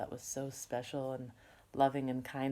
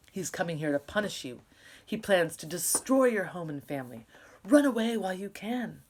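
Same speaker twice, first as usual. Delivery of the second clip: louder, pressing warning that builds to a cry; urgent warning; 3 semitones higher, 7 dB louder than usual. Acted. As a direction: warn them to run, louder and louder. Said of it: "the neighbor gasped".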